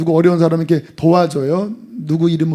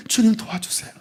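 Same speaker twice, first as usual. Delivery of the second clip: soft plea; 7 dB quieter than usual. Pretend. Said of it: "주님, 내 힘으로 안 됩니다".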